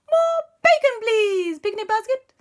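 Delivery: very high, loud fairy squeak; high Nanny Plum voice. Acted. Nanny Plum asking the picnic basket for bacon, squeaky high. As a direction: pipe it up like a tiny fairy. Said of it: "Nanny Plum said".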